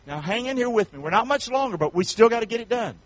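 normal talking voice

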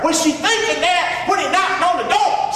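preaching shout. Real, not acted. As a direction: fire the question at them. Preacher shouts rhetorical question.

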